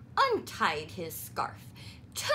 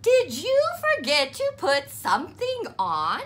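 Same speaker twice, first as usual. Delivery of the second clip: lightly voiced mother, higher and louder; mother quizzing Froggy; mother asking; 8 semitones higher, 7 dB louder than usual. A narrator's reading. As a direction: ask with a knowing tease.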